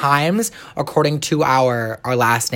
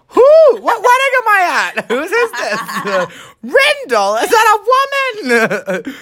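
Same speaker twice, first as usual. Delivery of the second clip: higher, laughing confused relatives; 3 dB louder than usual. Retold or imitated. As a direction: play baffled relatives at a wedding, through laughter. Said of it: "half my family's gonna go".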